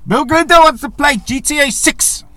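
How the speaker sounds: high, loud mock German accent, shouted; bad German accent. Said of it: "In my very shitty accent there".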